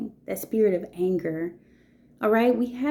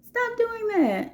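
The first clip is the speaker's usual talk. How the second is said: higher and slow, spelling out the correction; high retold scolding; slower than usual. Teacher retelling how she told a fifth grader to stop, high and slow. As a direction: pull the child up short, sharp and high.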